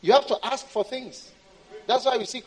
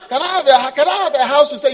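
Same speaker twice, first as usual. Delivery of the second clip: high, loud begging; pleading believer; 6 semitones higher, 12 dB louder, faster than usual. Acted. Believer begging God for the car, pleading.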